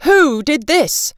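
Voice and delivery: lightly voiced cross father; father fuming at the ruined coats; angry father. Father finds sleeves sewn shut.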